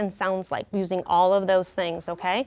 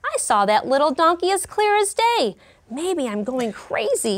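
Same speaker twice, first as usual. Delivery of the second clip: lion muttering; 5 semitones higher than usual. Acted. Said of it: "he muttered".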